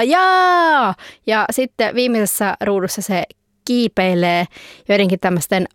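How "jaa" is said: high, loud cheering yell; shout. Acted. Comic character cheering Finland's seven, loud high yell. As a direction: yell it out in triumph. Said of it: "toi tyyppi huutaa".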